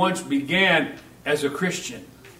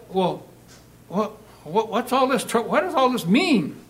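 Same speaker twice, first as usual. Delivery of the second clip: put-on puzzled older son; 5 semitones higher than usual.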